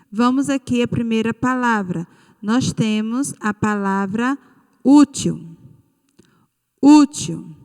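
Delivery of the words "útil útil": "útil" with a loud, punched "ú"; stress on first syllable. Shown as a demonstration. Teacher asking for the tonic syllable, loud stressed "ú" in "útil". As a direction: hit the "ú" hard in "útil", let the end fall. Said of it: "Qual é a sílaba tônica".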